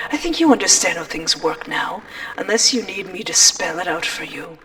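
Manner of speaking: ordinary talk, nothing marked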